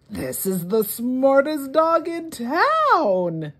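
Mister Smith telling Miss Brown, amazed and slow; impressed neighbour. Acted. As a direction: lean in and marvel at the dog, slowly.

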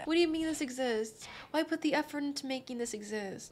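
high, hushed mock complaint; mock whining. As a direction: whine it softly, up high.

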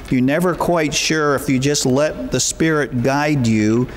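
ordinary talk, nothing marked

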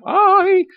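loud, high falsetto yelp; squeaky cry of alarm; comic yelp. Pretend. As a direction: squeal out a drawn mock cry.

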